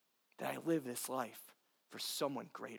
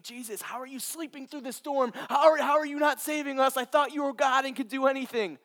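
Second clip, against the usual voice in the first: panicked disciples; 11 semitones higher, 13 dB louder than usual. Acted. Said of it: "they saying".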